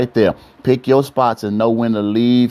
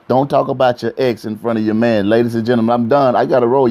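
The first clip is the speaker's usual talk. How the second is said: usual speaking voice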